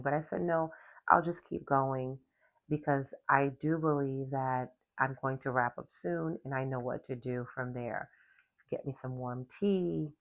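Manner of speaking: normal talking voice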